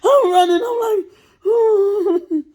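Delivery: high panicky laughing whimper; laughing squeal. Real, not acted. Fleeing the drill sergeants.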